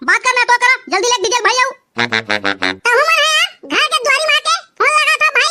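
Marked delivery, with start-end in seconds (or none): 1.7-5.5 ऊँची, बदली हुई फ़ोन वाली आवाज़ में डीजे वाला; फ़ोन पर बेफ़िक्र डीजे वाला बनकर बोलो; डीजे वाले की आवाज़. Acted. DJ operator on the phone. (दूल्हे के दोस्त से फ़ोन पर बात करता डीजे वाला, ऊँची आवाज़ में)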